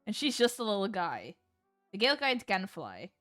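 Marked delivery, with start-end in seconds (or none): none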